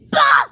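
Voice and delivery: sudden high, loud bang noise; imitated door slam. Storyteller voicing daddy slamming the door, loud sudden bang.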